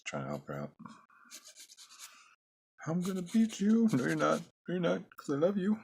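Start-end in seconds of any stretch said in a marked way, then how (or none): 2.9-3.9 high, loud cartoon taunt; a cartoon baddie shrilling a threat; squeaky villain taunt. Drawn fighter voiced as a character. 3.9-5.8 high, loud cartoon-character voice; a cartoon hero squeaking back with love; squeaky cartoon reply. Second drawing answers in character.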